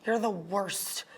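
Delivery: low, slow, quiet contempt; exasperated disgust. Acted. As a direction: sigh it out slowly, low and soft. Exasperated insult to arch nemesis.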